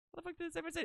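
ordinary talk, nothing marked

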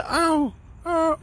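high puppy yelp, imitated; dog yelp imitation. Host imitating the dog's cry for viewers, high yelp. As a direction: yip like a hurt little dog. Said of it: "En una le hizo así hasta".

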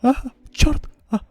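usual speaking voice

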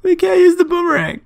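very high-pitched fake wail; mock wailing. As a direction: wail it like an exaggerated tragedy. Mock grief over lost boomerang.

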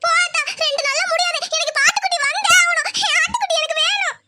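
put-on high-pitched protesting child; demanding little girl. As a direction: whine up high, refusing to wait. Child insists on her lamb. (அடம்பிடிக்கும் சிறுமியின் உயர்ந்த குரல்)

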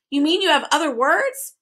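high, delighted bird question; gleeful bird interrupting; happy cry. A narrator's reading. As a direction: pipe up happily. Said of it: "cried the bird happily".

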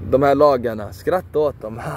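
normal talking voice

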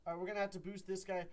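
ordinary talk, nothing marked

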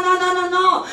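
normal talking voice

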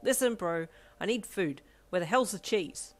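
hangry demand. A narrator's reading. Squaring off with Ida.

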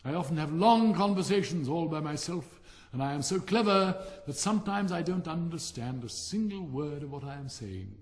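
pompous Rocket. Acted. Vain firework boasting to the silent Dragonfly. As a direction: preen and show off, loving your own voice.

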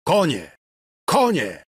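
high, loud one-word shouts; calling out. A narrator's reading. Broken Ksawery calls for horses, loud high cry. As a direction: call for it twice, urgently. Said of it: "mógł tylko zawołać".

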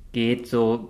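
ordinary delivery, nothing marked

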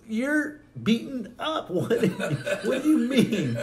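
very high, loud, mock incredulous question; hammed-up bewilderment with laughter breaking in; baffled [name]. Mother answering her beaten son, mock bewildered.

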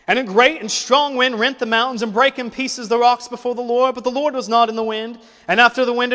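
loud, high, driving sermon delivery; fiery preaching. Acted. Preacher rousing the congregation about life's trials, loud and fervent. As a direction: preach it out to the whole church, full force. Preacher builds to full force.

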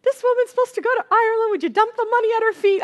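high, fast, loud excited proclaiming; excited prophecy. Retold or imitated. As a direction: call it out fast like a sudden prophecy. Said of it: "Christina just spontaneously ... says".

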